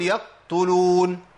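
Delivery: يقتلون slow with overdone bounce; overdone qalqala; wrong stretched qaf. Shown as a demonstration. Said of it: "ولا أقول".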